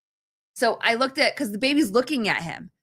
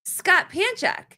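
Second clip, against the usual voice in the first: much higher imitation of the kid; excited little boy; 9 semitones higher than usual. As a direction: play the child bursting with news. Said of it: "and goes".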